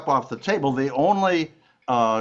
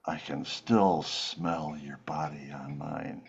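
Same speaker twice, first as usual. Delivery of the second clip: lower, softer and slow seductive murmur; mock whisper; 10 semitones lower, 9 dB quieter, slower than usual. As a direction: breathe it slowly into her ear. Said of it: "he whispers in her ear".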